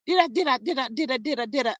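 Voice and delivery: mock stutter on "did I". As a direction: trip over the words on purpose. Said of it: "you got me stuttering too".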